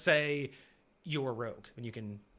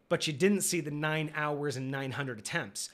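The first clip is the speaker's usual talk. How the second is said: ordinary delivery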